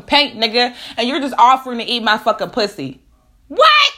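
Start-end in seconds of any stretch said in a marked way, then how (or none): 3.5-3.9 very high loud what; stunned outrage; shocked what. Real, not acted. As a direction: recoil in disbelief. Shocked reaction.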